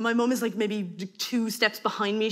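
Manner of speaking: ordinary delivery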